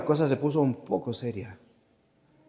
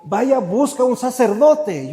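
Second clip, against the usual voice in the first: leader's urgent order; 7 semitones higher, 4 dB louder than usual. Retold or imitated. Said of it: "el líder dijo".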